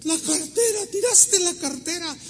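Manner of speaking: high-pitched excited calling out; mock yelling. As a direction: imitate a woman crying out the news. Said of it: "no, le grita".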